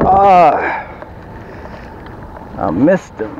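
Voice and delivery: ordinary talk, nothing marked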